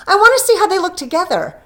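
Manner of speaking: loud, high and rushing; loud, eager delight; eager excitement. A narrator's reading. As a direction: she can't wait to show him. Della eagerly shows her gift.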